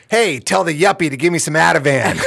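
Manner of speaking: loud high shout; yelling across room. Retold or imitated. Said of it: "client just yell across the clinic".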